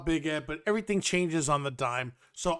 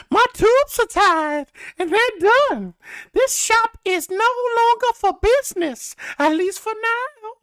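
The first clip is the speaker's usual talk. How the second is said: much higher, louder mock southern accent; prim southern lady voice, way up high; southern belle; 6 dB louder than usual. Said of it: "her southern accents".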